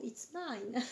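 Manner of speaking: a hammed-up French take on an English accent; joke English accent. Proud of her new oracle deck, mock English accent. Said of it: "Avec mon super accent anglais".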